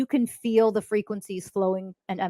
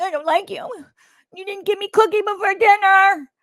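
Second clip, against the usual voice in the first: put-on high, whiny little-kid voice, building louder; mock toddler tantrum; 11 semitones higher, 4 dB louder than usual. Toddler denied a cookie, hammed-up high tantrum. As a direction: imitate a toddler wailing in protest. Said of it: "when your toddler throws a tantrum".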